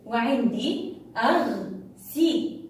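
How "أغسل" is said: slow, segmented أغسل; split into syllables. Shown as a demonstration. Teacher reviewing the class's words, syllable by syllable. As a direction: sound out أغسل with a pause after each syllable. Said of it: "ونريد أيضًا أن نقطعها".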